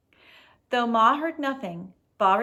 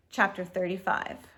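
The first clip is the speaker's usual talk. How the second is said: ordinary delivery, nothing marked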